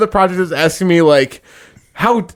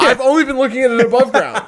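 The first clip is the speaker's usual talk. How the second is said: higher, put-on voice of a clueless worker; mock defensive; 6 semitones higher than usual. Worker joking to his friend in a mock defensive voice. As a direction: play dumb about what lies underground.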